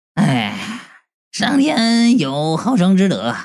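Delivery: slow mock-pious lament; sighing. A narrator's reading. Narrator seeming to pity the two ghosts, sighing piety. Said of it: "我叹了口气".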